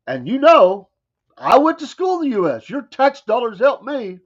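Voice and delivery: lampoon him, pitch up and push it; mock voice of envoy. Host putting words in the envoy's mouth, high mocking voice. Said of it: "He didn't say that part".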